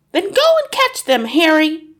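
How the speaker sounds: very high, loud urging; urgent hiss. A narrator's reading. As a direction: order your husband into action. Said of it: "hissed the mother".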